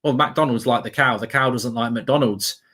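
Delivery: normal talking voice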